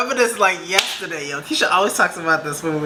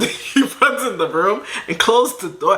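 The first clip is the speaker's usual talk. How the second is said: ordinary talk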